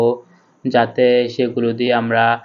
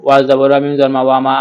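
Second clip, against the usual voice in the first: usual speaking voice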